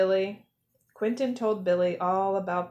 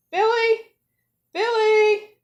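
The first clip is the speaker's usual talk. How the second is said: high, loud calls into the storm; a mother shouting for her lost chick; calling out; 12 semitones higher, 7 dB louder than usual. A narrator's reading. Billy's mom calls for him in the snowstorm, loud.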